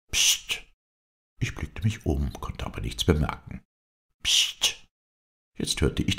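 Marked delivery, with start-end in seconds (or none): 0.1-0.6 sharp unvoiced hiss for attention; short hissed "psst" from hiding; secretive psst. A narrator's reading. Hidden friend signalling the narrator from above, secretive hiss. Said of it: "einen ganz eigentümlichen Laut vernahm". 4.2-4.9 sharp unvoiced hiss for attention; short hissed "psst" from hiding; secretive psst. A narrator's reading. Hidden friend signalling again from up the tree, secretive hiss. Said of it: "dass der Laut von oben kam".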